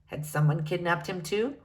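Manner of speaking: plain reading voice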